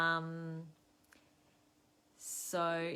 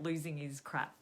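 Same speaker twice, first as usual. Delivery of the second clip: normal talking voice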